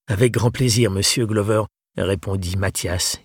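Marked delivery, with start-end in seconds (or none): none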